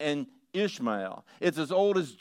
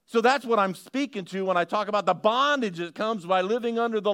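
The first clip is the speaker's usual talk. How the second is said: higher, louder, urgent preacher's cry; full-force preaching; 8 semitones higher, 6 dB louder than usual. Acted. Pastor warning the church about living under law, impassioned preaching. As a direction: drive it home to the crowd, fast and loud.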